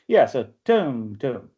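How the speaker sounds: "tum, tum" as a strong beat then a weak one; scanning beats. Shown as a demonstration. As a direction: tap out the trochee.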